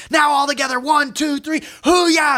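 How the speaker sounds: high, shouted count into a cheer; rallying shout. Acted. Speaker leading the whole audience, rallying shout. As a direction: count them in and belt it.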